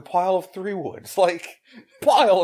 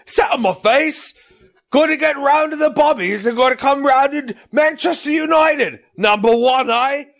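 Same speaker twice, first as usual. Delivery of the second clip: loud, high hammed-up Cockney accent; Cockney impression; 11 semitones higher, 8 dB louder than usual. Imitating a friend doing Cockney, loud and high. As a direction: ham up a Cockney geezer. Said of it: "He's doing Cockney".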